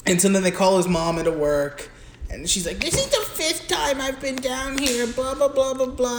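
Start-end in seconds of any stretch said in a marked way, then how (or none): 2.8-6.2 high, put-on voice of a complaining mother; fed-up mom. Mom called from work scolding the school, mock exasperated. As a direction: imitate a nagging mom, pitched up throughout.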